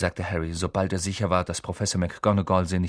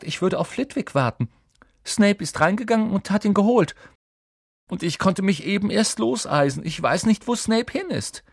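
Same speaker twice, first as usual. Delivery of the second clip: put-on high-pitched, fretful girl's voice; upset explanation, pitched well up; plaintive; 11 semitones higher than usual. A narrator's reading. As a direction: pour out the failure as a lament. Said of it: "klagte sie".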